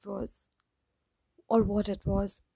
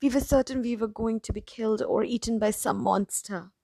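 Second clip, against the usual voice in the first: plain reading voice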